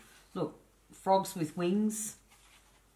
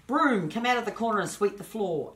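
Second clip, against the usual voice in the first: commanding the broom; 5 semitones higher, 5 dB louder than usual. Acted.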